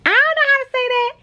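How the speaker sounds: quick, very high aside; baffled high pitch. Real, not acted. Vlogger stumped by a word she reads aloud, baffled high pitch. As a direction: blurt it high and fast, stumped. Stumbles on word while reading.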